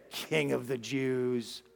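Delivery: low, soft, drawn-out mockery; mocking. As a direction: stretch it out slowly in mock reverence. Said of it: "and they mocked him".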